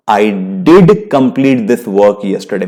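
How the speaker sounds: "did" punched, slow deliberate pace; stress on did. Shown as a demonstration. Teacher answering a blame, stressing did. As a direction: hit "did" hard and keep the rest even. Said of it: "मैं बोलता हूं".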